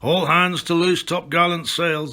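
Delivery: captain bellowing orders on deck; captain's call. A narrator's reading. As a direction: call the crew to the sails. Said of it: "I heard the captain call".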